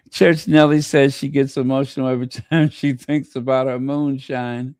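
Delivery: reading the comment through real laughter; laughing while reading. Real, not acted. Reading a viewer's comment aloud, laughing through it. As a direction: laugh through the line, fast.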